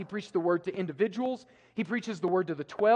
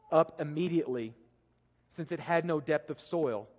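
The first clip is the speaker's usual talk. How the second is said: scripture reading; 3 semitones lower than usual. Acted. Reads the parable aloud.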